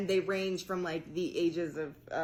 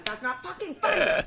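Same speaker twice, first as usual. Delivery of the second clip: higher and louder laughing protest; laughing outburst; 9 semitones higher, 7 dB louder than usual. Real, not acted. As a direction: blurt it out, half laughing. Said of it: "That's not funny, guys".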